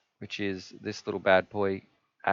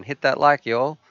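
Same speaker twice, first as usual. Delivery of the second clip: put-on louder, higher-pitched American accent; 6 semitones higher, 8 dB louder than usual. Streamer reading out a viewer's comment, playful American accent. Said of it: "in his American accent".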